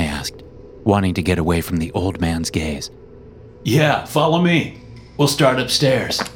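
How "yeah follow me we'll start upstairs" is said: put-on young man voice. Jerry voiced.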